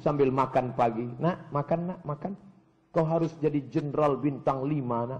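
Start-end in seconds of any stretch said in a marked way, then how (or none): 1.2-5.2 soft, coaxing murmur; tender parent. Acted. Parent talks to child over breakfast, soft and tender. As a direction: coax the child gently.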